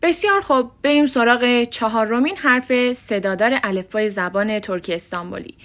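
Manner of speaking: usual speaking voice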